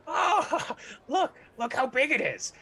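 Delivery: very high gnome laughing in delight; giggling gnome. Acted. Gnome marvels at the device, very high giggle. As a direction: giggle in amazement. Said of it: "he looks and he goes".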